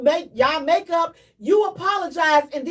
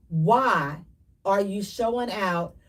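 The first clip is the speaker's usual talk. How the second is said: normal talking voice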